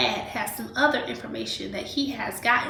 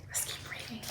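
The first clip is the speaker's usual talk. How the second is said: soft whispered aside to the class; 14 dB quieter than usual. Real, not acted. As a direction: lean in and whisper it. Teacher whispers a transition.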